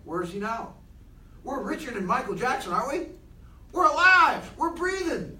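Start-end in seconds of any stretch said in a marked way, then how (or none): 3.7-5.4 higher, louder burst of joy; excited exclaim. Real, not acted. Speaker to the group, excited proclamation. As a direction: proclaim it like good news.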